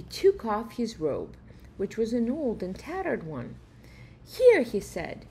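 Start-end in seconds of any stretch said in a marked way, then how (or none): 4.2-4.6 high, loud "here"; eager offer. Acted. Uncle stops the fleeing robber, high and loud. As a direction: call him back before he leaves.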